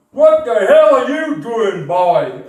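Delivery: loud, high, slow American bark; American shout. Retold or imitated. Big American airman catching a boy, loud American shout. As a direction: catch the kid red-handed, American drawl.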